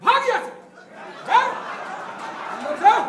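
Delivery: loud, high snapping command; angry order. Acted. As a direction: drive him out sharply. Commander orders man away.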